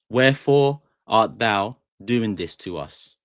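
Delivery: slow, stretched "Wherefore art thou" reading; slow dramatic demo. Shown as a demonstration. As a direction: linger on each word like a stage actor. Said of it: "In a sentence".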